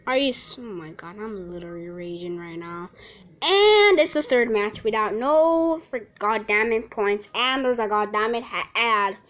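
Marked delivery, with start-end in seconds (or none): none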